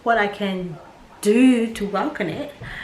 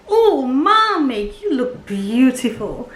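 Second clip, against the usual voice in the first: son's voice; 4 semitones higher, 4 dB louder, slower than usual. Retold or imitated. Son's compliment voiced in story.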